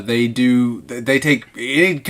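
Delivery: usual speaking voice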